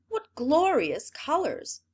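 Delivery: high, loud, slow gush of admiration; a courtier's showy rapture; gushing praise. Acted. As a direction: swoon over the colors. Officers praising the empty loom.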